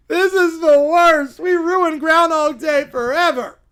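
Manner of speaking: loud, slow wail of doom; despairing kid tantrum. Acted. Kid lamenting a ruined Groundhog Day, loud wail. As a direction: wail each word like a devastated child. Kids despair over canceled holiday.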